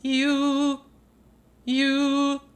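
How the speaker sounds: "You" sung high with the tongue pulled back; swallowed dark vowels. Shown as a demonstration. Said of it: "Doing it right now".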